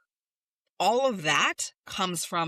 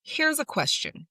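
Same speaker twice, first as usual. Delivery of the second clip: sure and bright, pitched up high; confident; 9 semitones higher than usual. Shown as a demonstration. Coach shows the confident way to ask, upbeat. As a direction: say it like you own the question. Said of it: "I want you to practice saying instead".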